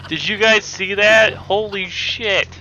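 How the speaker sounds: high, loud, stunned disbelief; amazed shout. Real, not acted. Amazed at tank flipping.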